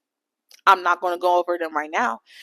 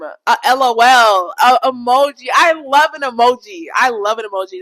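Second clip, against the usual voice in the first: emphatic loud; 8 dB louder than usual. Real, not acted. Listing how guys should text her, loud emphatic. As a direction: punch each item out.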